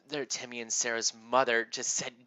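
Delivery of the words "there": usual speaking voice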